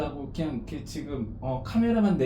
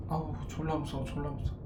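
much softer frightened murmur; scared; 11 dB quieter, faster than usual. Real, not acted. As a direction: whimper it, too scared to speak up. Scared on crystal floor. (작게 떨며 무섭다고 중얼대기)

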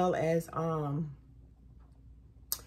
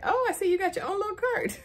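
high, fast, laughing tease; light imitation of a teasing manager; playful high mock of the manager; 12 semitones higher, 3 dB louder, faster than usual. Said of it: "she was like".

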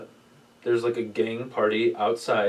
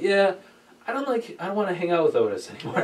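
high, rushed mock panic; panicked retelling; 7 semitones higher than usual. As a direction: blurt it out nervously, fast. Own retold panicked reply.

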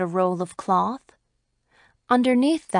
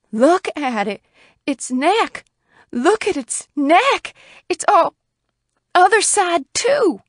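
horrified cry climbing higher and louder; 9 semitones higher, 5 dB louder than usual. A narrator's reading. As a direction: cry out in mounting alarm. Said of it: "cried Missus Hale".

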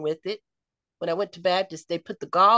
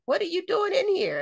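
imitated astonishment, pitched way up; retold surprised question; 11 semitones higher than usual. Friend finding the patient in her hospital room, surprised. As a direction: voice a friend's startled discovery, high throughout.

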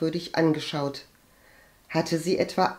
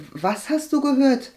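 higher-pitched, hesitant query; puzzled question; 8 semitones higher, 4 dB louder than usual. A narrator's reading. Agathe asks her cousin about rumours, puzzled. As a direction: ask it curiously, not yet pushing. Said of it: "fragte Agathe".

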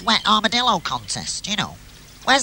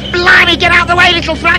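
alarmed yell; 11 semitones higher, 10 dB louder than usual. Acted. Taxi driving at them erratically.

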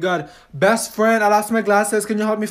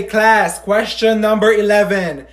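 normal talking voice

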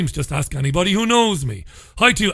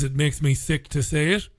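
normal talking voice

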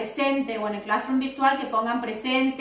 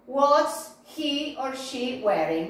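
slow, measured drill question rising at the end; model for repetition; slower than usual. Shown as a demonstration. Teacher has the class repeat the question, slowly. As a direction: lay the question out slowly, word by word.

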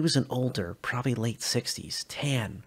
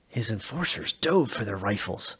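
plain reading voice

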